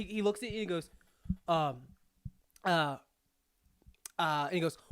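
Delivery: ordinary talk, nothing marked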